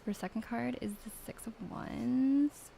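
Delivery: lightly put-on Valley Girl accent, sing-song rise. Mean Valley Girl accent reading the second card. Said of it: "I need to do Valley Girl accent".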